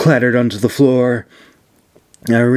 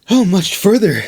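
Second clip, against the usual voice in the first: winded, voice pitched well up and louder; out of breath; 9 semitones higher, 3 dB louder than usual. A narrator's reading. Astari, winded from the stairs, asks her companion. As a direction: ask it between breaths after the stairs. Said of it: "struggled to regain her breath".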